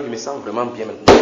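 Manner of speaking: usual speaking voice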